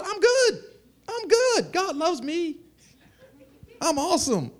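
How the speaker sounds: high, laughing, smug voice; a high, chuckling, smug churchgoer; smug self-praise. Pretend. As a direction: play the self-satisfied believer, high and pleased. Mocking self-satisfied churchgoer.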